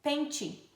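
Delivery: "pente" ending in "chee"; T as tch. Shown as a demonstration. Teacher giving the example for tch, "pente" with tch. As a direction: say "pente" as PEN-tchee. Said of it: "E tch".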